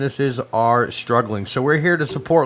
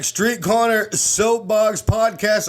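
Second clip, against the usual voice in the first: announcer voice; 7 semitones higher than usual. Acted. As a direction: introduce the show like a ring announcer. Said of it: "your homeboy got a official fucking announcer voice".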